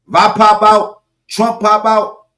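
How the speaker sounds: loud high shout on pop; hyped shout. Real, not acted. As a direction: hype the crowd up. Hyped exclamation.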